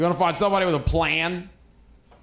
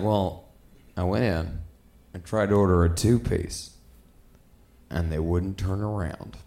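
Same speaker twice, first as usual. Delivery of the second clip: passive friend; 11 semitones lower, 4 dB quieter than usual. Retold or imitated. Passive friend explaining the chicken joint, low meek voice. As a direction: mumble it meekly and low. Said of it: "And he was like".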